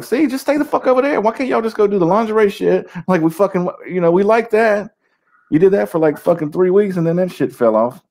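high-pitched, acted-out griping; mock dismissive complaint. Mock dismissal of women wanting into football.

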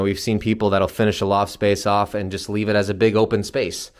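usual speaking voice

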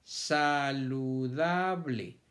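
saludable drawn out slowly, syllables apart; slow syllable split. Shown as a demonstration. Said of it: "¿Cuántas sílabas tiene la palabra saludable?".

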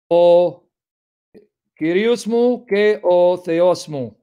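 slow "O Kyrios mu ke o Theos mu" with Greek vowels; Greek pronunciation. Shown as a demonstration. Reading John's Greek to students in careful Greek pronunciation. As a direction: draw out "Kyrios" the Greek way, word by word. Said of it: "Trying to pronounce like Greeks would".

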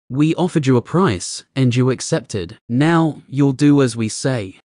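usual speaking voice

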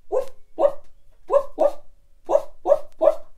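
loud high whooping dog noises; excited dog. Shown as a demonstration. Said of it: "can you stay there and be a".

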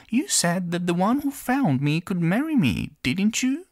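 tiny princess, happy and high; little girl voice. Acted. Princess coaxing her father.

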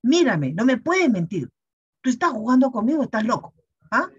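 scolding. Retold or imitated. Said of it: "le levanté la voz ya".

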